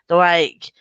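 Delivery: normal talking voice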